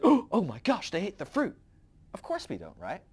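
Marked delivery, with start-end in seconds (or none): 0.0-1.6 high, loud gasping dismay; hammed-up horrified discovery; mock shock. Preacher mimics a surprised God, mock shock. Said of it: "Do we think that God was like".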